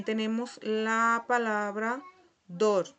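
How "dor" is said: dor as one firm, loud syllable; Portuguese pronunciation. Shown as a demonstration. Teacher shows how it is pronounced, dor loud and clear. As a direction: model dor clearly, set apart. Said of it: "se pronuncia dor".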